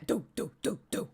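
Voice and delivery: steady rhythmic doo-doo knocks; imitated thumping noise. Streamer mimicking noise from her laundry, rhythmic thumps. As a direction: sound out an even mechanical thump.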